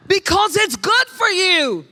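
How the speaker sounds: high, slow mom catchphrase; mom's cliche called out. Shown as a demonstration. Pastor leading moms in a call-and-response, calling it out. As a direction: sing it out like a mother scolding. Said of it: "You have to say it after me".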